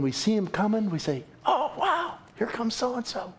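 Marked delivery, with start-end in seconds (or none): 1.5-3.3 high, bright-eyed oh wow; mock-thrilled welcome, pitched high; delighted welcome. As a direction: greet them like the best news of your day. Delight at a blessing coming.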